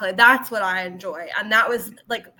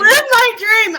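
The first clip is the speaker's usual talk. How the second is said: higher and louder, proclaiming; mock dramatic; 11 semitones higher, 9 dB louder than usual. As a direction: declare it like a grand wish. Echoes dramatically.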